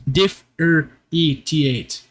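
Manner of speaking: Differentiate drawn out very slowly, dif-fer-EE-tee-ate; Differentiate, stretched and effortful; slow careful try. Shown as a demonstration. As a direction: sound out Differentiate at half speed. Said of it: "that sounds a lot less correct".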